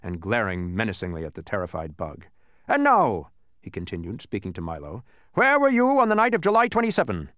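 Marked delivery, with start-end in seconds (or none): 2.7-3.3 high, loud interrogator's lead-in; officious policeman. A narrator's reading. Policeman turning on Milo, officious and loud. 5.3-7.3 high, loud accusing question; interrogating policeman. A narrator's reading. Policeman questioning Milo as suspect, loud interrogation.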